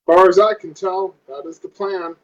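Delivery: ordinary talk, nothing marked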